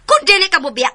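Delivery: high, loud furious threat; angry shout. Acted. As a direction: shout it at him in rage. Mother threatens to leave.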